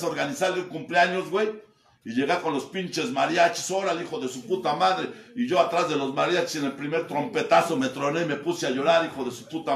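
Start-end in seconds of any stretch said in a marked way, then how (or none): none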